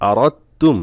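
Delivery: teacher repeats আরত তুম firmly with the silent dal; dal dropped. Shown as a demonstration. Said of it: "দাল শুধু লেখার ক্ষেত্রে আসবে কিন্তু পড়ার সময় আসবে না".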